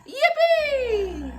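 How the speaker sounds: very high, loud squeal of joy; cheering Amelia. Acted. Amelia cheering in the library, very high and loud. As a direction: shriek it with delight.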